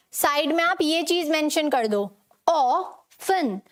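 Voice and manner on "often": often in two separate parts, "aw ... fun"; often slowly split, T silent. Shown as a demonstration. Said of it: "अब इसे बोलना कैसे है".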